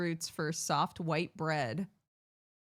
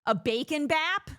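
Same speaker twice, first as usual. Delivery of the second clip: American accent on bacon bap, pitched up and louder; 9 semitones higher, 5 dB louder than usual. Shown as a demonstration. Showing off her American bacon bap, American accent. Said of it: "the American A".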